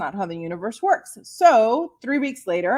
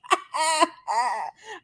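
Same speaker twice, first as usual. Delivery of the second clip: giggle; 6 semitones higher than usual. Real, not acted. Laughing at her own plan.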